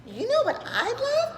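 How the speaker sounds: put-on high, slow child voice; character voice. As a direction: Skylar asking eagerly. Skylar speaks.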